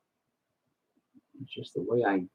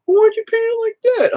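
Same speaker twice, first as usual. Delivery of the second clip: much higher, silly imitated voice; mock questioner. Painter mimicking a doubting viewer, high and silly. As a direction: squeak it like a nosy viewer. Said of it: "if anybody's like".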